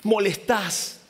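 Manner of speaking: reproche fuerte y más agudo; gente regañando. Acted. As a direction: callarlo con fastidio. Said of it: "se acerca y le dice". (la multitud le regaña con fastidio)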